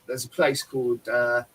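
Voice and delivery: ordinary talk, nothing marked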